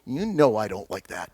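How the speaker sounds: a low, mild grumble from the dog; disapproving dog. Acted. Husky leaving after his ears are touched, mildly put out. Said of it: "he'd go away, and then".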